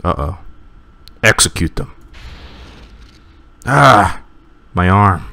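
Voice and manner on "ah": higher, louder yelp of pain; pained cry. Acted. Guard wounded in battle, pained cry. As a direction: cry out as the arm is hit.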